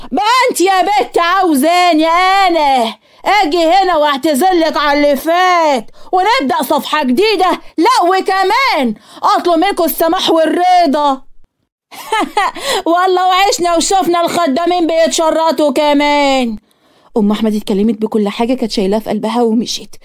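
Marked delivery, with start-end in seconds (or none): 0.1-11.2 high, slow, scornful aunt's voice; loud aunt. A narrator's reading. Aunt mocking Safwa at her door, high and scornful. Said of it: "وقالت بصوتها العالي". 12.0-12.8 high scornful laugh; mocking cackle. A narrator's reading. Aunt laughing at Safwa in contempt, high cackle. 12.9-16.6 high, slow, contemptuous aunt's voice; scornful aunt. A narrator's reading. Aunt sneering at Safwa before leaving, high and slow.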